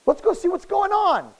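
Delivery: mock excitement, high pitched; playful high-pitched suggestion; feigned excited curiosity. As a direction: act out an eager discovery. Acted young man feigning surprise.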